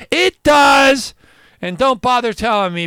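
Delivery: loud, high exclamation; emphatic. Real, not acted. Host insisting to doubters, loud exclamation. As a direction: insist loudly that it does.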